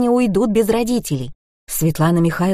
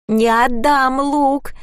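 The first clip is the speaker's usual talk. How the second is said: higher-pitched, drawn-out mock wail; bawling child, mildly acted; 7 semitones higher, much slower than usual. A narrator's reading. Little boy refusing to give up his toy, mock bawling. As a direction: narrator lightly voicing a bawling boy. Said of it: "ревел Коля".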